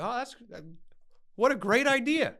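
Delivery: high, sarcastic mock enthusiasm. Son sarcastically answering his father's divorce plan, high mock cheer. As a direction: drip sarcasm on the great idea.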